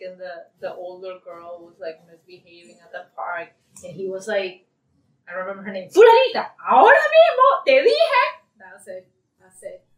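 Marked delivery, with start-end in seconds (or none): 5.9-8.2 high, loud scolding in Spanish; sudden parental shout, pitched way up. Retold or imitated. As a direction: imitate a dad yelling his kid into line. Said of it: "he switched to Spanish just like this".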